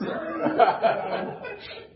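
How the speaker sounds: airy burst of laughter; unvoiced giggle; breathy laugh. Real, not acted. Laughing after the quote.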